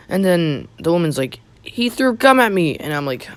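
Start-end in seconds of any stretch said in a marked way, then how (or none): 1.6-2.7 high, loud outcry of an outraged woman; mock shouting. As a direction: cry it out in a raised, high imitation. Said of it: "she starts shouting and screaming".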